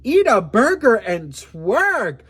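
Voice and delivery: drawn-out cheeky command; slow, loud, sassy dare; sassy drunk advice. Retold or imitated. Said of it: "I'm like".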